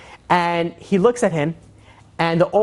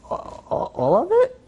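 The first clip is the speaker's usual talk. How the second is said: stammered whisper leaping to high pitch; shaken disbelief; 12 semitones higher, slower than usual. Retold or imitated. Collector handed the fat envelope, shaken disbelief. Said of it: "he's starting to shake".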